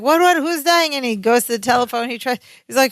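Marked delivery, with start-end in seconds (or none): 0.0-0.9 high startled questions; panicked what, who's dying; alarmed. Retold or imitated. Larry hearing someone is dying, alarmed.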